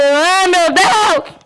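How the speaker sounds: high, slow ai meu Deus; drawn-out exclaim. Real, not acted. Gamer overjoyed, crying out to the viewers. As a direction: stretch it out in delight.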